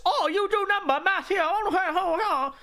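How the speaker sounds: high-pitched mock caricature voice. Streamer mimicking rote math for chat, mocking caricature. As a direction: put on a silly high voice, mocking.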